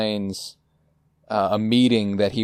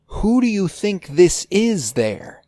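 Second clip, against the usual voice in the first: slow, higher, strange voice; Philip's odd voice; 9 semitones higher, slower than usual. Retold or imitated. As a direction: draw it out oddly and slowly. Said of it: "Philip points at Dale and goes".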